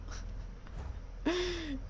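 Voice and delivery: high-pitched giggle; high, tickled laugh. Real, not acted. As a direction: giggle at your own silliness. Laughs at own silly deal.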